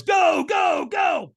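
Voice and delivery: high, loud, drawn-out shouting; urgent shout. Retold or imitated. Trainer sending firewalker onto the coals, urgent shout. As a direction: shout to launch him forward.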